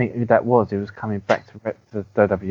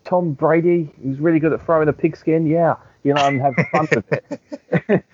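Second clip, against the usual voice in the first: high, gushing sports presenter voice; mock bubbly presenter; 7 semitones higher than usual. ESPN presenter gushing about Tom Brady, high mock bubbly voice. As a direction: gush like an airhead presenter. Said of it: "She's like".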